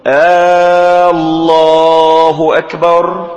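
loud, slow chant opening on a long "aaa" before الله; questioning hamza error. Shown as a demonstration. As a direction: mistaken muezzin call, first vowel of الله held long. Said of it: "فهو يقول".

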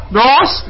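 "ناس" darkened to "nos"; wrongly heavy alef. Shown as a demonstration. Teacher repeats the error to reject it, heavy "ناس". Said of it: "لا، لا تفخم الألف".

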